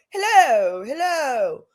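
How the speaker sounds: lightly imitated British "hello, hello"; a hint of a posh British greeting; British accent. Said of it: "They were British".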